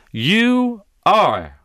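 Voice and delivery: "you are" punched loud and high; stress on are. Shown as a demonstration. Preacher correcting the tense for listeners, stress on "are". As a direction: hit "you are" as present fact. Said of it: "not will be".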